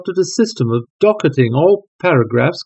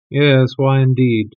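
plain reading voice

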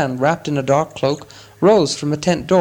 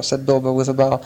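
usual speaking voice

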